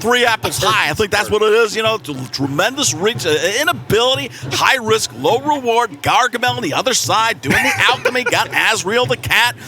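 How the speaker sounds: a hammed-up Kiper rant; Mel Kiper impression. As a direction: rattle it off like an eager draft analyst. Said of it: "do the impression of me".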